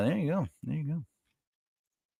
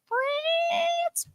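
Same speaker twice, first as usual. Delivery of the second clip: very high, drawn-out FRANCE; silly squeal; 5 dB quieter than usual. Pretend. Host joking about a mistake, squealed FRANCE. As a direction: squeal "France" in a long falsetto.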